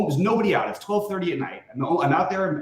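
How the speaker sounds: usual speaking voice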